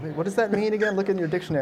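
high, teasing puzzled friend; a hint of a mocking bewildered friend; mock confusion. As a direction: tease as if baffled by old slang. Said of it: "they gotta dust it off and be like".

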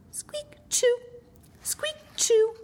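very high tiny squeak, half whispered; tiny high mousy squeak-sneeze; sneezing mouse. A narrator's reading. Narrator as a small mouse, squeaky sneeze. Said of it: "He sneezed too".

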